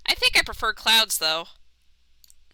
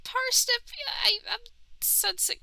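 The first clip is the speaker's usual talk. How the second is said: high soft voice; 14 semitones higher, 6 dB quieter than usual. Acted. Companion voice.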